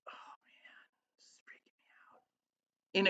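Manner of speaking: slow, voiceless whisper, retelling a thought; whispered aside. Retold or imitated. Speaker recalling watching a session and freaking out, slow whisper. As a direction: whisper it like a private reaction. Quotes own freaked-out private reaction.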